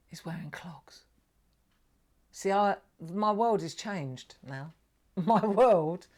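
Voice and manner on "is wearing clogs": whispered punchline; very soft, whispered realisation; hushed revelation. Real, not acted. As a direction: drop to an awed whisper. Hushed revelation of the misheard lyric.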